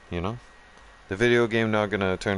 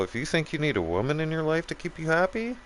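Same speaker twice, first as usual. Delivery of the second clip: if you think you need a woman pitched much higher, rising in disbelief; exasperated high retort; 10 semitones higher than usual. Retold or imitated. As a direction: fire it back high and incredulous. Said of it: "I'm like".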